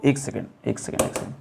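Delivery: low aside while fixing a mistake; quiet aside. Real, not acted. Teacher pauses to fix board.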